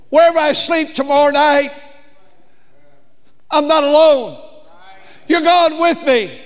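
loud, high, climbing sermon cry; fiery preaching. Acted. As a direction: build it up like a revival preacher. Preaching at full force.

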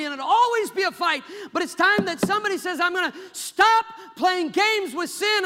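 loud, high, impassioned pulpit delivery; full-force preaching. Real, not acted. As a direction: drive it home like a fired-up preacher.

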